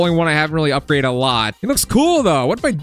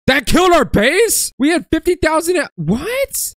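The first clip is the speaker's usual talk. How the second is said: high, loud, stunned outburst; can't believe the base fell; shocked disbelief; 12 semitones higher, 3 dB louder, slower than usual. Real, not acted. Base destroyed despite huge health.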